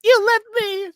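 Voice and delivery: very high-pitched mock accusation; shrieky imitation of a scorned woman; jilted bride. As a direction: wail it like a hammed-up jilted lover. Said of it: "And she's like".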